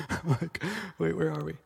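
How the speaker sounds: soft laughing self-mockery; quiet chuckling aside; amused confusion. Real, not acted. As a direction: laugh at your own confusion. Lost his place, laughs.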